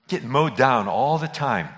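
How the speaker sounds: usual speaking voice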